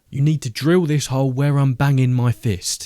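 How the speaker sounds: loud, forceful boss's order; calling through a wall. A narrator's reading. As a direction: project the instruction so it carries through a wall. Boss shouting instructions through a wall.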